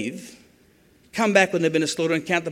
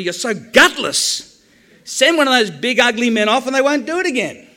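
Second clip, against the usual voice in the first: angry rant at the referee; 5 semitones higher, 5 dB louder than usual. Retold or imitated. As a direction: yell your disgust at the ref. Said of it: "I go nuts 'cause I say to the referee".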